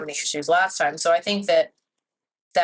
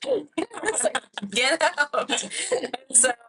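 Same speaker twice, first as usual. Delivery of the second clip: laughing through the words; giggling retelling; laughing mock order; 8 semitones higher, slower than usual.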